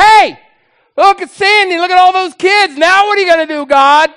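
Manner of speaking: high, loud acted shouting; urgent confrontation. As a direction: call out in alarm and demand an answer. Holy Spirit confronts God, acted.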